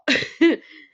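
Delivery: short bright burst of laughter; laughing. Real, not acted. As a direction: laugh it off, out loud. Laughs at own plan.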